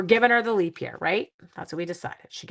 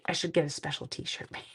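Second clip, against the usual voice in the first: soft conspiratorial whisper; hushed teasing aside; whispered joke; 2 semitones lower, 6 dB quieter than usual. Real, not acted. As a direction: whisper it like a secret plan.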